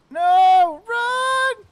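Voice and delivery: panicked high shout; scared horror game streamer. Real, not acted. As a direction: scream it in panic. Fleeing enemies.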